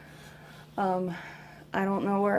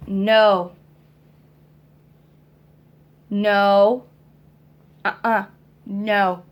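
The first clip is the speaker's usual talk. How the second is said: much louder, firm repeated no; loud scolding no; 9 dB louder, much slower than usual. Real, not acted. Mother stopping toddler, raised voice.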